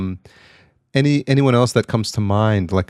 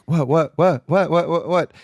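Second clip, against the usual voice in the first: higher, repeated comic questioning; imitating confused bystander; 8 semitones higher, slower than usual. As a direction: fire off bewildered little questions. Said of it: "the one in the middle".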